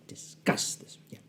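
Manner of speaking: whispered rehearsal on the stairs. Retold or imitated. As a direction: breathe it to yourself. Rehearsing under his breath on stairs.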